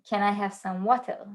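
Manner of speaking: imitated Hebrew-accented English, crisp T in "water"; heavy Hebrew accent. Daughter imitates mom ordering at McDonald's, heavy Hebrew accent. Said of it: "Hebrew accent, and she said".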